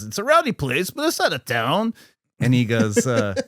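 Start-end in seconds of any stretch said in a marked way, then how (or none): 0.0-1.9 a lightly voiced, higher Cheech line; Cheech quote. Fan lightly imitating Cheech describing El Rey, a bit higher. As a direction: toss it off like a shady guide.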